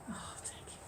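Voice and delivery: put-on breathy whisper of an old woman; old woman whispering thanks. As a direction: whisper it gratefully.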